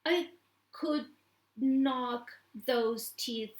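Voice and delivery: usual speaking voice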